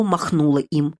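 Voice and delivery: ordinary delivery, nothing marked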